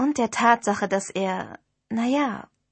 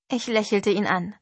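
usual speaking voice

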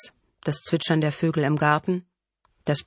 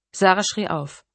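plain reading voice

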